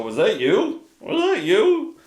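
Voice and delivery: high, rising questioning squeak; puzzled dog voice. Pretend. Dog questions what is between its legs, high puzzled voice. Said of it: "look between their legs and go".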